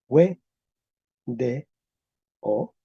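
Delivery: "we de o" in three separate syllables; vowel change to E. Shown as a demonstration. Teacher applies rule four for class, vowel change to E. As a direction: say "we de o" apart, w followed by e. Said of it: "Con P cuatro".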